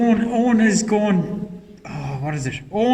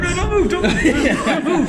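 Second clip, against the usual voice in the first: mock panicked voice; 10 semitones higher, slower than usual. Joking outburst over listener's laughter.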